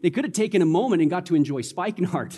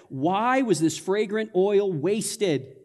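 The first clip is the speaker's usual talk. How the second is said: ordinary talk, nothing marked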